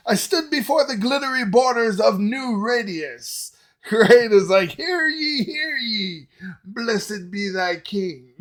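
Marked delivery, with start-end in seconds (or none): 0.1-3.5 high, loud explorer proclamation; Christopher Columbus. Acted. Music reactor hamming up the lyric as an explorer, mock-grand. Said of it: "it sounds very Christopher Columbus to me". 4.7-8.3 high, drawn-out town crier call; hammed-up herald, slowing on the blessing; mock old-time proclamation. Music reactor parodying the lyric's old-world style, hammed-up proclamation. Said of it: "it sounds very Christopher Columbus to me".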